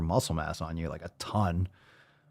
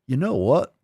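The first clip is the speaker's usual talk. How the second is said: lightly voiced politician impression, pitched up; Bill Clinton; 5 semitones higher than usual. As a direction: put on a folksy presidential voice. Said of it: "talk like Bill Clinton".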